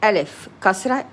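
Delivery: ordinary talk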